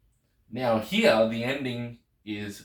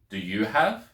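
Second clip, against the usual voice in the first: "have" rising at the end as a question; questioning intonation. Shown as a demonstration. Teacher translating a Greek question, questioning intonation. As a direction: lift "have" into a clear question. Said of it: "as long as there's a rise in tone".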